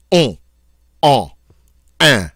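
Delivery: "on" and "un" short and crisp, N unsounded; forceful nasal vowels. Shown as a demonstration. Teacher demonstrates French nasals, very forceful. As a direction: punch nasal "on" and "un", nothing lazy. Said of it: "are very forceful".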